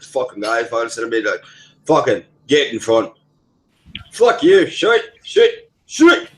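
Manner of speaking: hammed-up Irish rant; Irish accent. Shown as a demonstration. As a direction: put on a heated Irish accent. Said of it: "you should hear my Irish accent".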